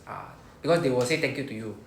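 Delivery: usual speaking voice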